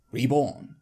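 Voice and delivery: usual speaking voice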